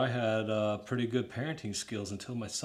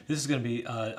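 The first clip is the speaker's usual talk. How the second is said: ordinary talk, nothing marked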